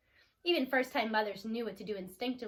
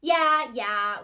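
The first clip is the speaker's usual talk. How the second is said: eye-rolling; 9 dB louder than usual. A narrator's reading. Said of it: "Tess rolled her eyes".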